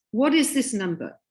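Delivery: normal talking voice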